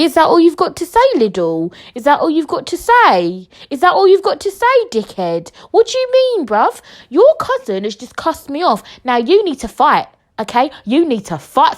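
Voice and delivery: high-pitched exasperated ranting at someone absent; indignant confrontation. Retold or imitated. Podcaster confronts Lidl over the cousin, indignant.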